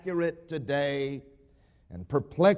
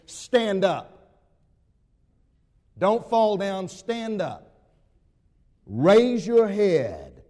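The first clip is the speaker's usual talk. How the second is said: raised, emphatic preacher's command; ringing command; 4 semitones higher, 5 dB louder, slower than usual. Acted. Preacher giving Jesus's charge to the congregation, ringing command. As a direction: build to a loud, slow, drawn-out final call.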